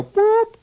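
high, loud imitated whistle blast; a sharp high whistle sound with the voice; whistle boop. Lab men blow the whistle at the squirrel, high loud boop. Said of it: "they go".

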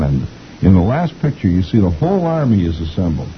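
ordinary delivery

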